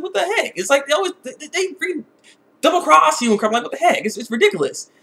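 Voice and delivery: usual speaking voice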